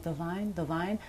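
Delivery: soft, careful calling of her name; gentle call. Retold or imitated. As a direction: approach with caution, barely above a breath. Gently calling scared daughter.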